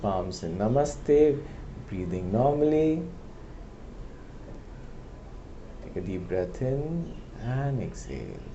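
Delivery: unhurried meditation voice with long pauses; calm, softened guiding voice; guided meditation. Real, not acted. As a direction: gently guide listeners inward, leaving space between phrases.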